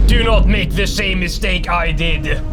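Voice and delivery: usual speaking voice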